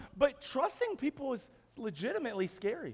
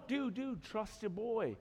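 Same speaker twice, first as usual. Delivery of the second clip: soft, hushed buddy reassurance; mock reassurance; 7 dB quieter, slower than usual. Joker answering a worried friend, softly reassuring. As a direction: soothe a doubting pal quietly.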